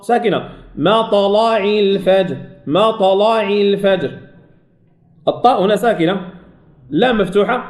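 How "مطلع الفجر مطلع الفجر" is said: slow tajweed chant, heavy L in "مطلع"; heavy lam in recitation. Shown as a demonstration. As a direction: chant "مطلع" slowly with a thick, dark L. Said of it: "تفخم اللام".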